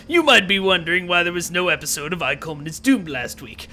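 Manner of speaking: usual speaking voice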